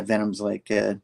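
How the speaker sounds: ordinary delivery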